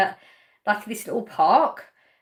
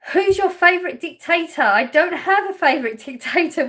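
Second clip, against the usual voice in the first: amused surprise; 6 semitones higher, 5 dB louder than usual. Real, not acted. Reading a startling chat question.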